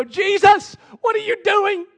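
high frantic yell to Jesus; panicked shout. Acted. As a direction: scream it over the storm. Disciples crying out to Jesus.

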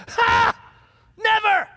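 defiant boast. Retold or imitated. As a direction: scoff and shout it like a proud disciple. Peter boasting he'll never deny.

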